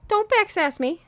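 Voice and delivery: high, loud playful scold; high mock scolding. Real, not acted. Owner scolding her cat for meowing back, high and playful. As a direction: tell off a sassy cat, way up high.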